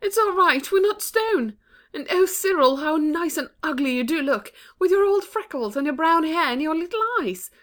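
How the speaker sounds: tearful joy. A narrator's reading. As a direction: relief bursting out of her. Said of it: "almost in tears for joy".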